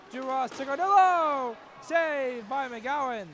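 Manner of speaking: loud rising call, then a slow drawn-out save; excited call. Real, not acted. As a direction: ride the chance, then stretch the save. Scoring chance and save.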